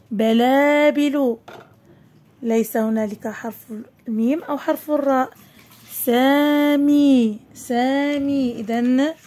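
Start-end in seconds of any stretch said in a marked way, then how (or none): 0.1-1.4 very slow, stretched vowels; each long vowel held; drawn-out word reading. Shown as a demonstration. Teacher sounds out word for pupils. 5.9-8.5 very slow, stretched vowels; each long vowel held; drawn-out word reading. Shown as a demonstration. Teacher sounds out word for pupils.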